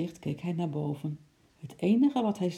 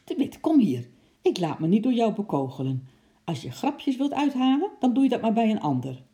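loud, higher furious rant, racing at the end; angry scolding; 6 semitones higher, 5 dB louder than usual. A narrator's reading. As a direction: tell him off in a rage. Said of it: "riep hij boos". (woedend uitgevaren)

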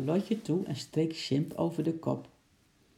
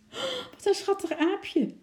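very high, gushing exclamation; enthusiastic cry. A narrator's reading. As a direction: gush over an adorable little animal, pitch way up. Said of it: "riep ze enthousiast". (enthousiaste, hoge uitroep van vertedering)

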